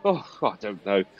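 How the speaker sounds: high indignant protest sinking into doubt; flustered Philpott. Acted. Philpott answers Pinkham, indignant then faltering. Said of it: "And, uh, Philpott".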